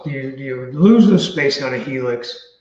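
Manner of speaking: ordinary delivery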